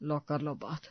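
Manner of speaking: put-on a grumpy old man rolling his eyes; softly muttered sarcasm about boiled food; sarcastic grumble.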